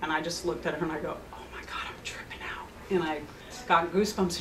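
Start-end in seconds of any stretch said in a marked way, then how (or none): 1.3-2.7 hushed, voiceless amazement; awed whisper. Retold or imitated. Therapist to client on reading the hawk's meaning, awed whisper. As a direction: whisper it as if stunned by the coincidence.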